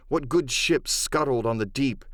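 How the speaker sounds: usual speaking voice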